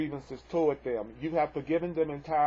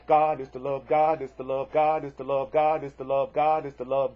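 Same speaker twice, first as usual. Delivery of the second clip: "God" hit louder on every repeat, rhythmic chant; stress on God; 5 dB louder than usual. Shown as a demonstration. Teacher chanting the lesson for students, "God" stressed loudly. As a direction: pound out "God" at the top of each short phrase. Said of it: "And I'm gonna say it for you right now".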